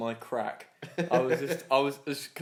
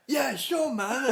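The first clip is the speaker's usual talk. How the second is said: much higher, gushing mock voice; enthusiastic boss; 12 semitones higher, much slower than usual. Big boss greeting him back from the rain, mock enthusiasm. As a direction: imitate an over-keen boss. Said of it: "he was all enthusiastic".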